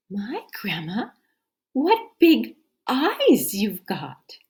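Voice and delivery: put-on girl's wonder. Girl's famous question.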